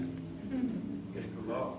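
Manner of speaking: slow breathy whisper of the phrase; whispered aside. Acted. Preacher muttering the phrase to himself, then repeating it, whispered. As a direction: drop to a slow, hushed whisper.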